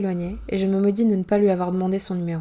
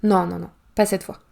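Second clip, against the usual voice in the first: usual speaking voice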